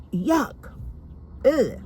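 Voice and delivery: put-on high, grossed-out child's yuck; disgusted yuck. Little girl wiping her mouth after the kiss, disgusted. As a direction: wipe it off, revolted. And I went.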